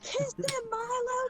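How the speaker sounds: high excited egging on; cheering. Acted. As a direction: cheer them to kiss. Cheering the couple on.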